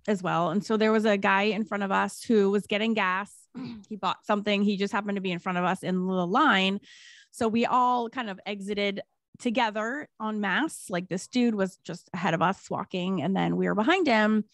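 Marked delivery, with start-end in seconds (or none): none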